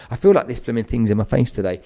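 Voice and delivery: ordinary talk, nothing marked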